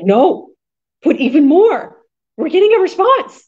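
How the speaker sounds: mock pep-talk command, building high; excited push.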